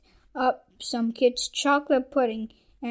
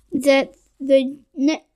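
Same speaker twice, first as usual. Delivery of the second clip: ordinary delivery, nothing marked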